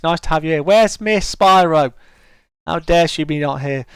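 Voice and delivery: usual speaking voice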